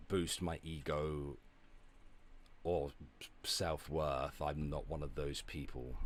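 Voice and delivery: usual speaking voice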